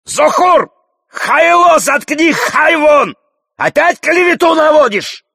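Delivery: furious high-pitched yelling; bellowing from afar. A narrator's reading. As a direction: hurl insults across the room at full volume. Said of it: "Хирург вопит издали". (яростно вопит издали)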